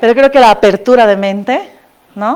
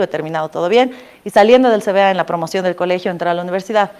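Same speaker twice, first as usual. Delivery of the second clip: ordinary talk, nothing marked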